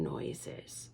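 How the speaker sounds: trailing off into a whisper. A narrator's reading. Storyteller fading to a whisper for suspense. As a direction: let the word vanish in a whisper.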